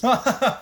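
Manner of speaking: burst of laughter. Real, not acted. Laughs at uncle's joke.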